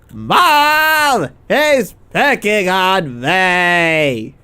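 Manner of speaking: imitated tattling wail, drawn way out; slow, loud mock whine; Tori's whine. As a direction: mimic a bratty kid calling for mom. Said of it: "And then Tori goes".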